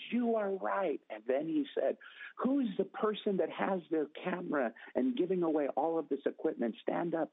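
high, bright announcement to the room; excited announcing. Retold or imitated. Pastor announcing the winner to the congregation, high and excited. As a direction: proclaim it like a host revealing a winner.

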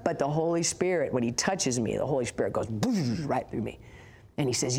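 ordinary talk, nothing marked

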